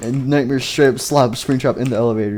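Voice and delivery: normal talking voice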